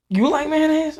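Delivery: high-pitched mock shock at the news; dad's surprise. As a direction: act the dad's disbelief. Dad's surprised retold question.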